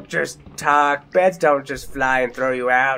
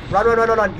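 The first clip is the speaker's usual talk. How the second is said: frantic urgent run calls; hurry, hurry, panicking; panicked; 6 semitones higher, 3 dB louder, faster than usual. Real, not acted. Fleeing the troll.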